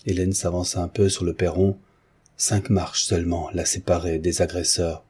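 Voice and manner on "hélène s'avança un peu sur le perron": usual speaking voice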